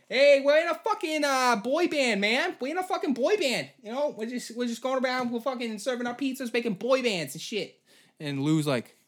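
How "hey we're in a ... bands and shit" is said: put-on goofy boy band kid. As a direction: brag like a clueless teen star. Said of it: "And there's like".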